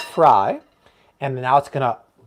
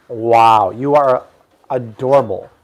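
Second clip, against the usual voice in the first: ordinary talk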